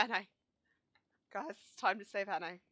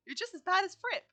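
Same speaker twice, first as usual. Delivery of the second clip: high, loud play-acted rebuke; acted accusation; 8 semitones higher, 5 dB louder than usual. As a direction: throw the accusation out, raised voice. Reads an accusation in a quarrel.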